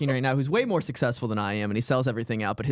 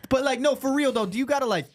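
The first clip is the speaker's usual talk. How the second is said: higher put-on street-talk voice of a comic; imitating the comic; 10 semitones higher than usual. Comedian quizzes the plumber, higher put-on voice. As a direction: ham up the comic's slang, pitched up.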